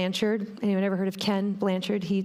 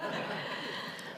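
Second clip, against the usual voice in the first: trailing, breath-only laugh; 13 dB quieter than usual. Real, not acted. Speaker amused by an audience joke about the lieutenant, soft laugh.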